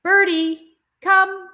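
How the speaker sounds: Birdie come, called high and loud; calling the cat. Shown as a demonstration. Owner calls her cat Birdie over, loud and high. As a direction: call your cat over firmly. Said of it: "I do the same thing with my cat".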